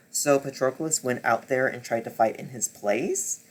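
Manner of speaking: ordinary delivery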